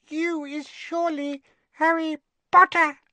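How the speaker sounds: very high squeaking voice; a house elf meeting someone famous; tiny elf squeak. Acted. Winky introducing herself to Harry, awed squeak. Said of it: "a teeny quivering squeak of a voice".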